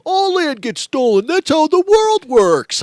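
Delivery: loud, high, drawn-out imitation of a yeller; hammed-up shouted comeback, stretched out; mock yelling back. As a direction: holler it slowly in a mocking high voice. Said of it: "And he yells back".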